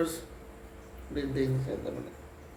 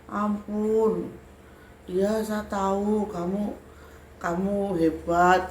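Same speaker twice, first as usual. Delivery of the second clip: slow, raised pleading whine; imitated tearful surrender; 5 semitones higher, slower than usual. Crying spirit admitting the teller is great, slow pleading. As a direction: draw the words out in a mild mock wail. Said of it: "di dalam nangis itu dia bilang".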